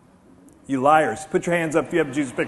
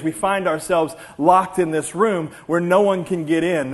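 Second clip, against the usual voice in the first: ordinary talk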